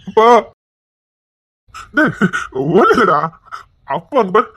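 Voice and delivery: usual speaking voice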